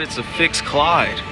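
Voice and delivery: ordinary talk, nothing marked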